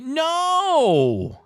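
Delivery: high, drawn-out falling no; emphatic rejection. Acted. Host rejecting the guess of S, drawn-out rejection. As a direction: hold it high, then swoop down.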